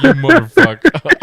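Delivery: high, loud laughing curse; mock outburst. Cursing at a friend who beat him, loud laughing outburst. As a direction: blurt it through a laugh.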